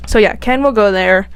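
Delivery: ordinary talk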